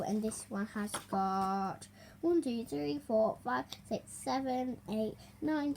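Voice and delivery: usual speaking voice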